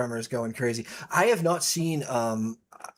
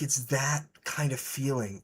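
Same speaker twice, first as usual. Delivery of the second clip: ordinary talk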